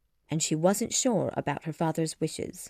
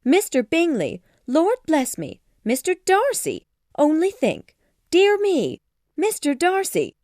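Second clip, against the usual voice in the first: very high, loud exclamations; overexcited mother; 10 semitones higher, 7 dB louder than usual. A narrator's reading. Mother reels at the two suitors, overexcited squeals. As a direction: burst out in short amazed cries. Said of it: "She was too excited to think clearly".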